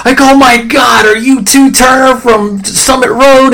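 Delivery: high, loud, imagined gushing reaction; big-deal excitement. Pretend. Imagined schoolmate recognizing an old friend, big-deal excitement. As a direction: play up a hypothetical starstruck greeting. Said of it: "this big deal".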